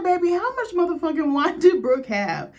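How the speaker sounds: high, loud incredulous cry; retold shocked exclamation, high and loud; shocked outburst. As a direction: blurt it out, stunned by the wine. Recapper retells her shocked reaction.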